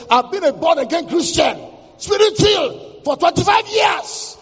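high, rising wife's declaration, last words drawn out; emphatic retort. Retold or imitated. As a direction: proclaim it like a triumphant comeback. Wife retorts proudly to the policeman.

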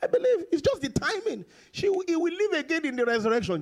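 high-pitched retold protest; acted impatient reply. Preacher acts out the answer to Jesus, high mock protest. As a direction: mimic a hasty assent, pitched well up. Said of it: "He said".

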